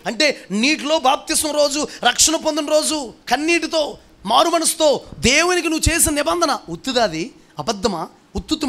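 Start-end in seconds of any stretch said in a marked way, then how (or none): 0.1-7.0 high, ringing preacher's cry; impassioned preaching. Acted. Pastor challenging his church over baptism vows, high impassioned preaching. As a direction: lift it up and press the congregation hard. Preacher rising to full force.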